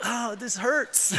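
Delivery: slow whiny complaint into a laugh; mock pained laugh. Son taking hits from dad, mock pained laughing. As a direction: laugh through a fake ouch. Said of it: "and I'm like".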